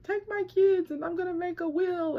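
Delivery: high, rushed panic; frantic plea. Retold or imitated. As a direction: blurt it out like the end has come. Frantic call to brother.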